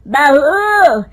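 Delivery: loud, high, drawn-out call to another room. Acted. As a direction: holler for the daughter-in-law, stretching the words. Mother-in-law calls for tea. (ज़ोर से पुकारती सास)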